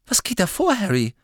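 lightly voiced alarmed girl; alarmed question. A narrator's reading. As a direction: demand an answer in alarm. Hermine alarmed.

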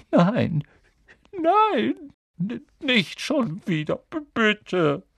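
high, stammering frightened plea; whimpering. Acted. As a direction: beg in a tearful wail, stumbling on first sounds. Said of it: "jemanden wimmern".

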